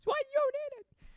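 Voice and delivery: extremely high squeal, mocking a wife; exaggerated screeching wife. Husband demonstrating the wife in his mind, shrieky mock accusation. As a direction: screech it as an absurd falsetto caricature. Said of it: "that's how she sounds".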